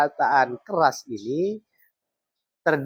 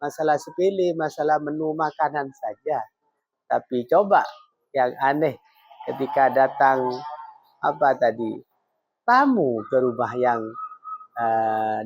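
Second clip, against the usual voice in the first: usual speaking voice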